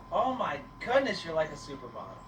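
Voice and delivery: ordinary talk, nothing marked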